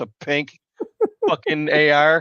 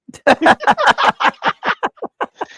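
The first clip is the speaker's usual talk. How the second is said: loud, high burst of laughter; big laugh; 6 dB louder than usual. Real, not acted. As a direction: crack up hard at the story. Laughs at his own story.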